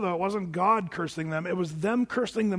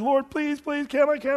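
higher pleading whine; whining; 8 semitones higher than usual. Retold or imitated. Balaam begging the Lord for permission, whining higher. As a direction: beg like a whiny kid. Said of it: "Balaam whines and whines".

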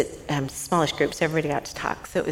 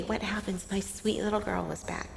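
normal talking voice

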